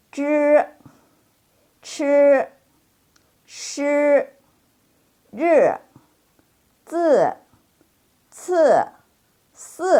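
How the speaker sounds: high, level, drawn-out Zhi, chi, shi; i not spelled out. Shown as a demonstration. Teacher showing silent i, held level syllables. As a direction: buzz each one without an ee sound. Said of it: "i-nya jangan diejak".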